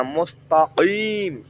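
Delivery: drawn-out মুস্তাকিম with a throaty qaf; correct Arabic pronunciation. Shown as a demonstration. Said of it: "এখানে মুস্তাকিম হবে না".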